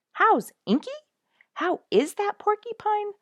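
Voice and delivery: high, loud, eager asking; excited stranger asking about the porcupine; eager question. A narrator's reading. Narrator repeats the eager question.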